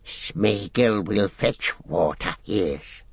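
slow, drawn-out creature voice; Gollum. A narrator's reading. Gollum agrees to fetch water for Sam, slow creature voice. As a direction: draw it out like a wheedling creature.

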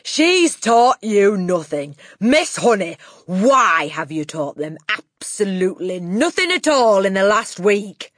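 loud, triumphant accusation; Trunchbull. A narrator's reading. Headmistress blaming Miss Honey before her class, loud triumph.

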